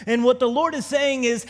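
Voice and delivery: ordinary delivery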